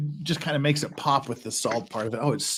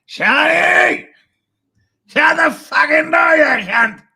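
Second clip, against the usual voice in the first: high, loud, drawn-out mock Australian yell; Lincoln's shouted Australian; 8 dB louder, slower than usual. Impersonating Lincoln yelling at Shani, shouted Australian accent. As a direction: bellow it in an exaggerated Australian accent. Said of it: "How was my Australian accent there".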